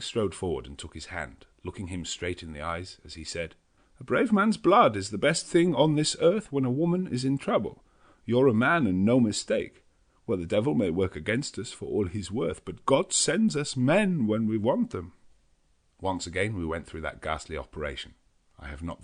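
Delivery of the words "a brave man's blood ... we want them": higher, louder fervent professor; earnest old Dutch professor; Van Helsing. A narrator's reading. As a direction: praise him with fire. Van Helsing praises Quincey.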